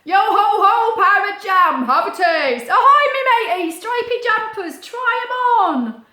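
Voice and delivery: loud, high pirate hawker calls; pirate market call. Acted. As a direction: shout your wares across the busy market.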